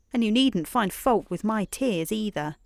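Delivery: ordinary delivery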